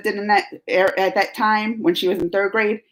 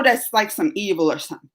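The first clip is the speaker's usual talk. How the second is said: ordinary delivery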